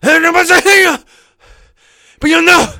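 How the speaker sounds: loud, high, out-of-breath rap yell. Retold or imitated. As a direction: yell gibberish like a winded rapper. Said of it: "I'm not even saying words there".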